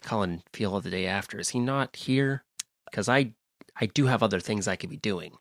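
low, soft celebrity levelling with the host; bad star impression dropping to a confiding tone. Let-down guest asking for the absent host, low and soft.

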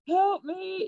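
high mock-desperate plea; retold plea for help. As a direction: plead for help, played lightly. Retells plea during hard contraction.

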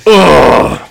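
high, loud pleasured groan; hammed-up delighted ugh; mock swoon groan. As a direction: melt at the thought of it. Swooning reaction to bike name.

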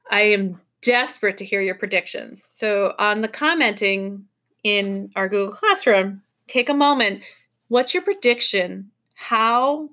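usual speaking voice

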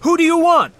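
high, loud shouted question; shouting over machinery. A narrator's reading. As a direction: yell it over the roar. Co-pilot yelling over helicopter noise.

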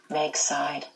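usual speaking voice

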